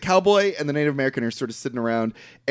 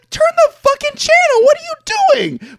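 very high, loud outraged shout; incredulous, hammed-up screaming; exasperated yell; 7 dB louder than usual. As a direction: yell at the characters as if they could hear. Yelling at characters in retold scene.

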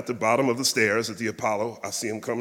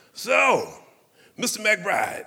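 much higher, louder formal address; 11 semitones higher, 5 dB louder, slower than usual. Retold or imitated. [name] addresses him at dinner.

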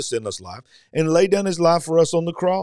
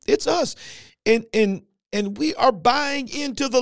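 full-force preaching; 6 semitones higher than usual. Acted. Preacher urging congregation, full-force preaching.

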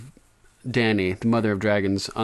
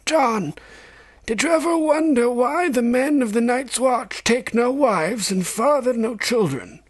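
put-on old maester. A narrator's reading. Old maester teaching Jon about ravens and crows, aged voice. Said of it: "Maester Aemon asked".

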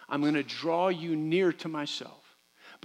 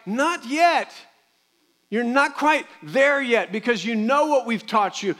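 parent setting limits; 7 semitones higher, 7 dB louder, slower than usual. Retold or imitated. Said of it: "we're like".

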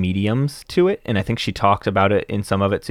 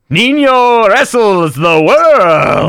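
slow, high, booming title call; hyped title announcement; 9 semitones higher, 6 dB louder, much slower than usual. Pretend. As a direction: announce the title like a wrestling event. Hyping up the book title.

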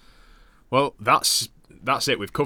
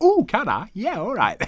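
imitating partner; 12 semitones higher, 5 dB louder than usual. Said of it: "And she's like".

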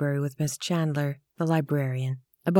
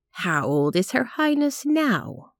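slow, higher, lightly voiced old lady teasing; a spinster fondly mocking the baby's royalty; playful old lady; 6 semitones higher than usual. Elderly lady to the young mother, slow playful tease.

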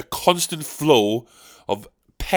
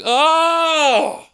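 high hammy shout; anguished wail; 11 semitones higher, 4 dB louder than usual. Acted. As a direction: wail in comic outrage. Old Dick Lips outburst.

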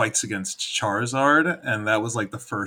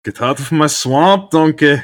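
much louder and higher mock Scottish rant; on request, a Scottish ogre's line in a mock Scottish accent; 7 semitones higher, 11 dB louder than usual. Shown as a demonstration. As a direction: bellow it like a cranky Scottish ogre. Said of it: "If you can lean into the Scottishness".

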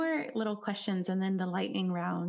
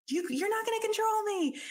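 defensive snap; 14 semitones higher than usual. Retold or imitated. Defensive quote at partner.

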